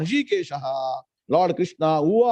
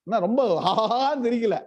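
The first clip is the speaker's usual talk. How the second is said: ordinary talk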